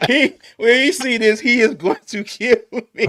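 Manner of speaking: high, loud laughing fit; laughing about getting in trouble. Real, not acted. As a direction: squeal it out through laughter. Laughing about Doug's reaction.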